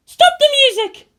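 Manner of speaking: high, loud barked order; a girl's commanding shout. Acted. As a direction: order them to stop, full force. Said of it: "she commanded".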